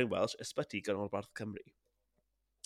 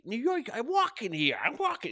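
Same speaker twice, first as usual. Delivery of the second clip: high-pitched, aggressive New Yorker impression; badly faked pushy New York accent; 12 semitones higher, 3 dB louder than usual. Host showing the accent he can't do, brash New York. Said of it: "I can't really do New York".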